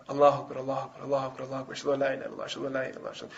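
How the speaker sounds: low, hushed, rapid mumble; quiet adhan. Retold or imitated. Scared boy giving adhan before the Prophet, very quiet and low. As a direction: murmur the call softly under your breath. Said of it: "he would say it quietly".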